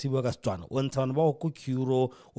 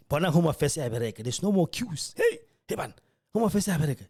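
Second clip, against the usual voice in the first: higher, exaggerated excited exclaiming; mock amazed praise; 6 semitones higher than usual. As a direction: ham up the crowd's delight that the office now works. Mimics people praising Home Affairs.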